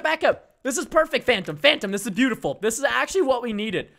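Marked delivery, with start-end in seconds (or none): none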